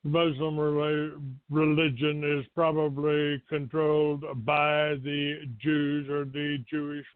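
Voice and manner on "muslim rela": ordinary talk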